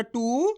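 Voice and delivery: टू drawn out, gliding upward; long vowel. Shown as a demonstration. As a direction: hold the oo of टू and let it rise. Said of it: "खींच खींच के इसका उच्चारण".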